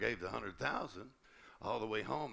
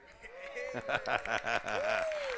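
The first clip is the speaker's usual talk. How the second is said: high, loud laugh; 6 dB louder than usual. Real, not acted. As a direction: burst out laughing. Laughs, rising to preach.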